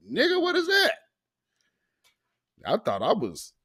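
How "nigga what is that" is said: high, incredulous mock question; baffled reaction. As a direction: act out total confusion, pitched up.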